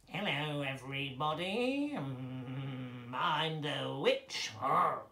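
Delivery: slow, drawn-out witch through the nose; nasal witch voice. Shown as a demonstration. Witch greeting everybody, nasal nasty voice. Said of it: "talks from this part of her nose".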